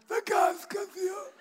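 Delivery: one final desperate yell; last shout. Acted. Furious gas collector's last yell before collapsing, strained shout. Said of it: "Schreit einmal noch".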